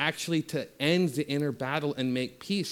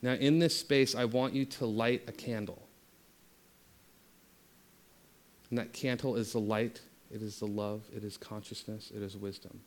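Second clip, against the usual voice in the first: guided meditation; 3 semitones lower, 5 dB quieter than usual. Acted. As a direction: lead them gently inward.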